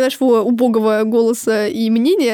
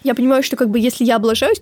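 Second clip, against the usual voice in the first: ordinary talk, nothing marked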